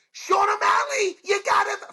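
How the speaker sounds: high, stretched-out mock shout; imitated yelling. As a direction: holler the fighter's name, hammed-up. Imitated yell, owns up to accent.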